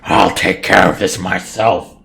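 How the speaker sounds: loud, high hag shouting in rage; furious yell. Acted. Blister berates Barnacle over the almost empty pool, furious yell. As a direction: yell it full force at a useless underling. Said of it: "Blister just yells".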